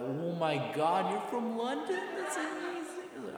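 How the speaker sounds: slow, very high mock squeal; gushing girls. Girls learning he's from London, mock swooning squeal. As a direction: squeal it like a starstruck schoolgirl, drawn out. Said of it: "all, they're like".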